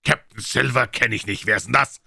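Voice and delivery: loud question hurled across; shouted retort. A narrator's reading. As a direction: shout back dismissively. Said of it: "rief der Captain".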